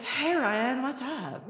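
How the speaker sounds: fake-casual greeting to the boy she likes; playing it super cool. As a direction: act unbothered and cool. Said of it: "So I was super cool".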